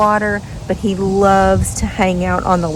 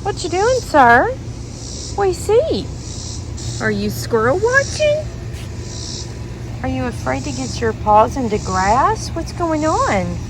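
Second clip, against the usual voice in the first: pet talk; 4 semitones higher, 6 dB quieter than usual. Real, not acted. Owner cooing at her dogs outside.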